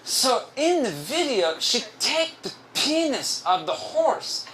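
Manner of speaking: high, slow singsong coworker impression; drawn-out, high imitation of a coworker; Ricardo. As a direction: stretch it out in his put-on voice, swooping up on key words. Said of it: "I'm gonna do his voice".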